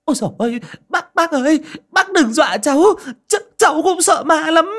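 high, loud panicked cry, near tears; frightened shriek. A narrator's reading. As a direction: squeal in fear. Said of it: "la toáng lên".

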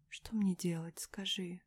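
hushed, whispered plea; faint, lost question; voice not her own. A narrator's reading. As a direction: ask very softly, half whispering. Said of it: "Вдруг произнесла не своим голосом".

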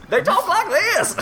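put-on very high, slow old-timey prospector; hammed-up, high-pitched prospector. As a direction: crow it like a cartoon prospector. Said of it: "what a prospector is".

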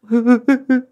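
high-pitched, stuttering sobs; acted crying burst; loud high sobbing. A narrator's reading. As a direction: break into wailing sobs. Weeping mother breaks down mid-plea.

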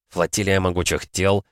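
ordinary delivery, nothing marked

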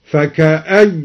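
فكأي cut off at the ya, "faka-ayy"; stop on ya. Shown as a demonstration. Teacher showing the pause, فكأي ending on "ayy". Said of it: "ووقف على فكأي بالياء".

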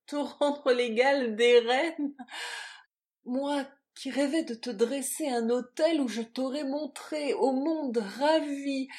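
building to loud, high impassioned declamation; distraught ardour. A narrator's reading. Lover declaring endless idolatry, fervent and frantic. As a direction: pour out adoration, swelling phrase by phrase. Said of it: "les paroles éperdues de son amant".

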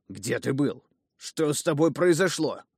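anxious father demanding to know; insistent questioning. Acted. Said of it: "не унимался Эпимас".

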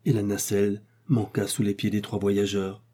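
plain reading voice

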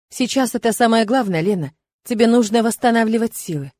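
ordinary delivery, nothing marked

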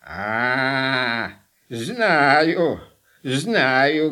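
low, slow, creaky crone; old witch voice. Acted. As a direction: drawl it out like Baba Yaga. Baba Yaga recognises the name.

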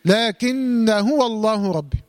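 slow chanted لكن هو الله ربي, "laakinna huwa", alif dropped; joined recitation. Shown as a demonstration. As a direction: recite لكن هو الله ربي as one slow phrase, short a after the held n. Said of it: "سقط وصلا".

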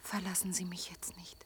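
barely voiced, pleading; whispered plea. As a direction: beg him in a breath. She begs him not to leave. (geflüsterte Bitte)

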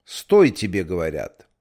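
loud commanding bark to halt; booming thunder voice. Acted. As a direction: thunder out the order to stop. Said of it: "громовым голосом скомандовал". (громовым голосом)

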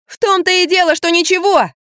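put-on high, heated girl's retort; seething. A narrator's reading. Girl arguing with Lampa, seething. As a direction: throw it back, pitch held high. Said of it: "кипела девочка".

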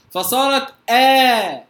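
the آ drawn out slowly; long alif. Shown as a demonstration. Quran teacher showing students the replaced hamza. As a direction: stretch the final آ. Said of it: "الهمزة الثانية على أصل القاعدة لورش".

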